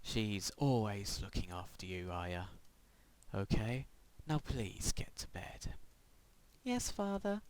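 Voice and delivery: quiet creepy father. Acted. Whispered father role.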